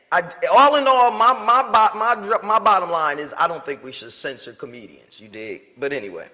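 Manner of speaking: ordinary talk, nothing marked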